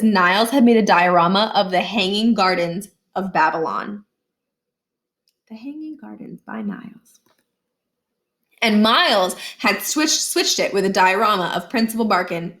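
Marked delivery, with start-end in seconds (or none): 5.5-7.2 hushed title. Acted. Niles's title read hushed.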